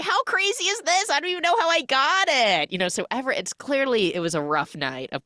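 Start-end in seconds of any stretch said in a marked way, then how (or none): 0.0-2.7 high dazed voice. Retold or imitated. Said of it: "he's like".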